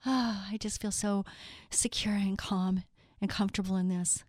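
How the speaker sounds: soft, gasped-in contentment, fading to a whisper; breath-led, whispery awe; hushed wonder. Acted. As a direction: sigh it softly like a child feeling safe. Voicing awed, secure little kids.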